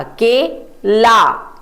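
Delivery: के and ला said apart, slowly; syllable by syllable. Shown as a demonstration. Teacher to children, sounding out the syllables slowly. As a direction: split के ला into its syllables. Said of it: "क को ए की मात्रा".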